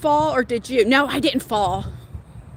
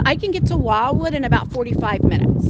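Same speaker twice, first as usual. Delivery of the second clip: ordinary talk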